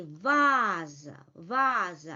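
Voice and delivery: "vase" as Russian VAA-za, long full first vowel; drawn-out first syllable in "vase"; stressed A. Shown as a demonstration. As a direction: hold the stressed "a" in "vase", falling away after it. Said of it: "under the stress, we, we may say".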